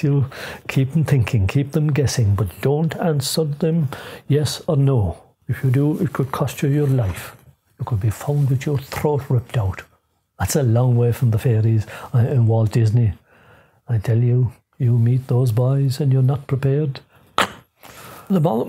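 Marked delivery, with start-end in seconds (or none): none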